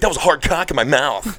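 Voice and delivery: lightly mock the comedian's punchline; Dane Cook impression. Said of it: "He'd be like".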